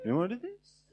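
"Do you wanna do this?" lifted at the end; light upbeat question on "Do you wanna do this?"; rising question tune. Shown as a demonstration. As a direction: end "this" on an upward lilt. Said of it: "It's just like".

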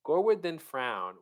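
ordinary talk

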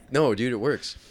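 normal talking voice

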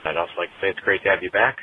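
normal talking voice

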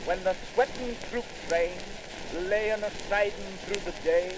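usual speaking voice

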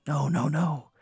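soft, high-pitched warning under the breath; urgent silent signal. Acted. Husband secretly waving the comedian off behind wife, hushed warning. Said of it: "he, like, motions you".

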